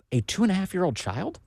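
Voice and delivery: disbelieving question, pitch rising high; incredulous. Real, not acted. Host repeating the child's age to his audience, stunned disbelief. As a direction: ask it as if you can't believe it. Repeating a shocking court detail.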